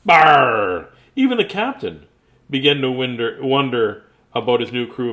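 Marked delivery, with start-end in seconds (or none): 0.0-0.9 a loud barking Barr; loud pirate growl. Acted. Captain shouting at the crew, loud pirate growl.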